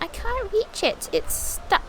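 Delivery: high, slow kitten whine; little kitten voice. Pretend. Voicing the kitten who can't reach her toy, high and slow. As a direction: squeak it out like a stuck kitten. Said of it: "She's like".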